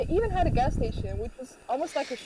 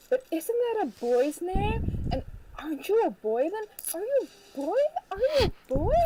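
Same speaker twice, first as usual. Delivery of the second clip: high, rising questions, imitating a nosy questioner; mock incredulous questioning; 8 semitones higher than usual. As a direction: pester with ever higher questions. Retold nosy questioning about her name.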